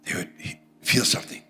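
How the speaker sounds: whispered, hushed with wonder; awed whisper. Retold or imitated. Preacher tells how listeners felt his voice, whispering. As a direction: breathe it out in a reverent whisper.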